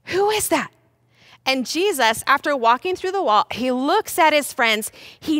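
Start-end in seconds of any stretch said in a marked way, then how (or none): 0.1-0.7 high, baffled question; pitched-up astonishment; startled disbelief. Acted. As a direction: ask in acted alarm. Stunned disciples spot Jesus.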